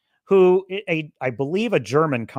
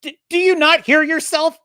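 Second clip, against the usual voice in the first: exasperated retort; 11 semitones higher, 5 dB louder than usual. Retold or imitated. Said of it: "And I'm like".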